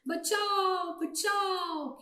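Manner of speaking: high, stretched-out calling; mock cry for help. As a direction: wail for rescue like trapped storybook birds. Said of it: "जोर जोर से शोर मचाने लगे".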